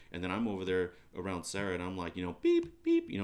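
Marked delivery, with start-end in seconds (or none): 2.4-3.1 high, loud mock bleep; imitated censor beep. Man owning up to cursing around Sarah, mock censor beeps. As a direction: beep like a censor over a curse.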